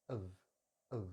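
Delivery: weak "of" with an uh vowel; reduced "of", schwa then V. Shown as a demonstration. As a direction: say "of" as a short "uhv". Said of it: "into a schwa sound".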